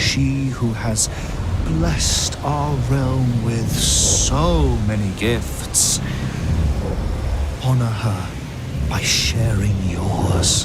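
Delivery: low, slow persuasive purr; slow coaxing. Acted. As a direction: draw it out to tempt her.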